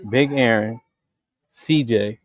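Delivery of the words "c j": ordinary delivery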